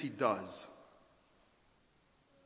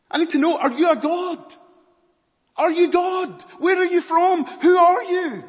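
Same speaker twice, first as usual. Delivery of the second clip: loud, high, pleading shout as Pilate; desperate shout; 14 dB louder than usual. Acted. Pilate desperately shouting at silent Jesus. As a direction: bellow the questions in panic.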